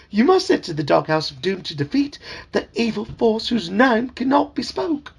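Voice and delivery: high, fussy talking frog calling him sir; chirpy, insistent little frog servant; eager frog voice. Acted. Dad voicing the talking frog for his kids, high and eager.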